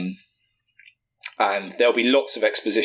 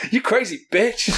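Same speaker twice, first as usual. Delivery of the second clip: mock outburst; 11 semitones higher than usual. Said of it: "obviously he won't drop an F".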